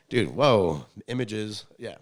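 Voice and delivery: ordinary talk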